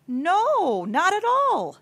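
high, loud "No, not at all"; ringing, emphatic refusal; emphatic denial. Real, not acted. Emphatic answer to own rhetorical question.

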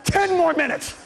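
high, slow, exasperated inner whine; a boy's hammed-up inner complaint, high; inner grumble. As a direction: complain to yourself, dragging it out. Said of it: "inside, I'm going".

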